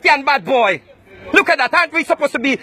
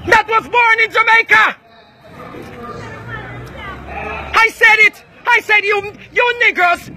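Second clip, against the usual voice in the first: shouting; 6 semitones higher than usual. Real, not acted. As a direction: scream it at them. Escalating shouting match.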